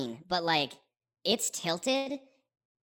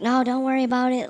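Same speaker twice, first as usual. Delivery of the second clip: higher, louder friendly refusal; generous farmer; 7 semitones higher, 8 dB louder than usual. Retold or imitated. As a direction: wave off the money kindly.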